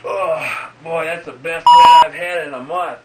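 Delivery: loud, pleased exclamation; guy walking off, loud and satisfied. Retold or imitated. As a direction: boom it out, pleased.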